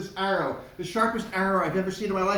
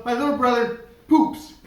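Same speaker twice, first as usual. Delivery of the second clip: put-on cheeky child; 3 semitones higher, 3 dB louder than usual. Kid's silly answer.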